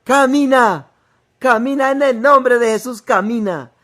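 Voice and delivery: high, loud forceful command; powerful shouted command; shouting in her face. Retold or imitated. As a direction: yell it right in her face. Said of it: "un hombre gritando en mi cara".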